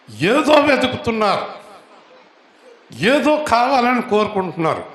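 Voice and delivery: high, loud fervent preaching; full-force preaching. Acted. Pastor to congregation about Mary seeking Jesus, loud fervent preaching. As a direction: preach it out to the whole church, rising high.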